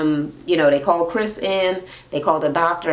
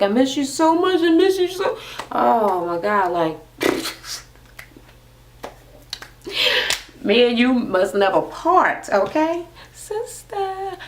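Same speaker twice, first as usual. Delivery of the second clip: gushing sister; 7 semitones higher than usual. Retold or imitated.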